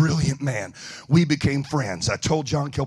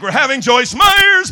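mock announcer; 9 semitones higher, 11 dB louder, slower than usual.

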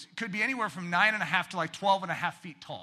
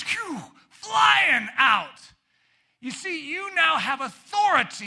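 full-force preaching; 6 semitones higher, 6 dB louder than usual. Acted. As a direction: drive it home from the pulpit.

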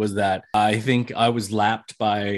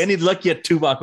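lightly imitated character, pitched up; a higher, practised Chakotay catchphrase; 6 semitones higher than usual. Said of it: "in the Chakotay voice".

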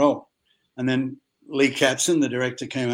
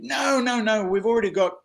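mock objection; 8 semitones higher than usual. Producer rejecting the Australian idea, objecting. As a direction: wave it away in a raised voice.

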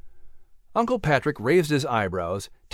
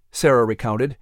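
usual speaking voice

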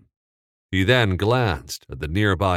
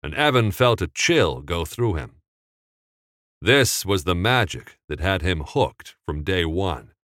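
plain reading voice

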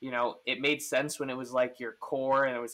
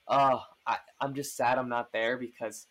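ordinary talk, nothing marked

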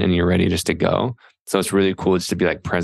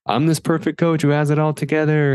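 mock perfect coach; 9 semitones higher than usual. Mocking idealized coach persona.

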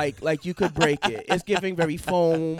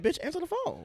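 high mock yell; 14 semitones higher, 3 dB quieter than usual. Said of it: "And I was like".

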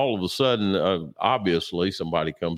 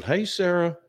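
cheery retold call; 11 semitones higher than usual. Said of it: "I blew past Sarah and I said".

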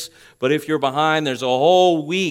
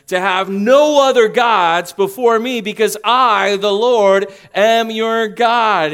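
high, loud, then a very slow climax on am your God; preacher voicing God at full force; booming preacher; 7 semitones higher, 7 dB louder, slower than usual. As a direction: proclaim it like God's own decree. Preacher thunders God's covenant words.

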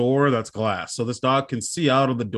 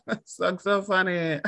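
high mock giggle, softer words trailing; fake amused laughter; 5 semitones higher, 5 dB quieter than usual. Mimicking coworkers' first reaction, high fake laughing. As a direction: imitate someone finding it hilarious, thin and high. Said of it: "at first it's like".

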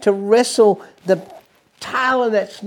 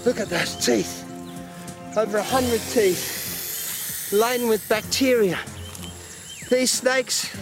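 excited wildlife host whisper; 3 semitones higher than usual. Acted. Wildlife host piece to camera.

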